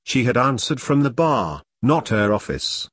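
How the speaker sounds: ordinary delivery, nothing marked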